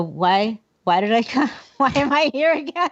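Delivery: amused, hammed-up bewilderment at your own forgetfulness; acted puzzlement. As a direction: play up being lost in your own house.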